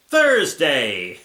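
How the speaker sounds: loud, drawn-out day announcement; mock announcement. Reader opens the next diary day, mock announcement. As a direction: call out the day like a title card.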